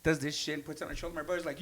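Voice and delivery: usual speaking voice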